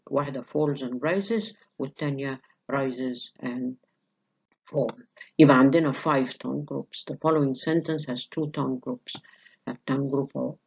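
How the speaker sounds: ordinary talk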